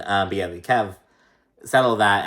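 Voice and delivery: ordinary delivery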